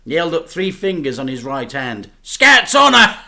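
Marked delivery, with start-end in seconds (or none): 2.2-3.2 loud, high and hearty; hearty oath. A narrator's reading. Dad swears to his son, loud and proud. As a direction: proclaim it proudly.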